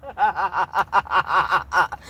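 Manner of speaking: long, low staccato chuckle; helpless laugh. Real, not acted. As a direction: crack up at your own slip. Laughs at own slip.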